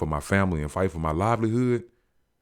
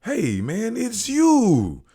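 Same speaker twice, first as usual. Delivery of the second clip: much higher, rising to loud starstruck gush; excited recognition; 4 dB louder, much slower than usual. Retold or imitated. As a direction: gush like a fan spotting a star. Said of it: "he excited".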